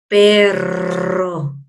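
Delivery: "perro" drawn out low with a long rolled RR; strong double R. Shown as a demonstration. Teacher showing pupils the double R, long trill. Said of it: "va a sonar siempre fuerte".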